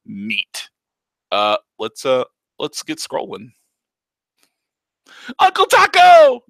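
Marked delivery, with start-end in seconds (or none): 5.4-6.4 put-on very high, loud, slow child's cry; a little girl squealing her uncle's name; excited child. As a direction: kid throwing herself at her uncle, squealing. Child character greeting.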